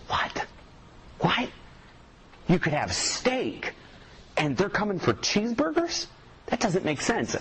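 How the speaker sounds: high, incredulous What? and steak talk; mock disbelief. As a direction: act baffled at the kids' choice. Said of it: "We're like".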